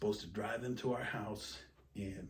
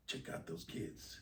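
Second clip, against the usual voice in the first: hushed aside; 4 semitones lower, 8 dB quieter than usual. Retold or imitated. Quietly telling wife about lice.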